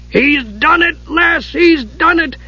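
very high, thrilled shriek; high-pitched character cry of triumph; excited shriek. A narrator's reading. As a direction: shriek the news in wild excitement. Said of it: "Dugan shrieked".

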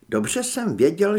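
plain reading voice

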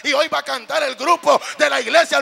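high, very loud rhythmic climax; full-force preaching. Real, not acted. Preacher picturing himself with Jesus in heaven, full-force preaching. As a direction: proclaim heaven's joy at full voice.

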